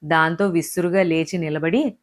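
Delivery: plain reading voice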